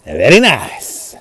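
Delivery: slow, drawn-out character voice; a mild mock character voice; put-on voice. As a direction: stretch it out slowly in a put-on voice. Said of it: "I do all the voices".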